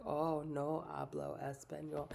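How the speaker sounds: put-on slow, lightly accented "no hablo español"; mock Spanish. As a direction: pretend not to speak it, slowly. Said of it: "I was like".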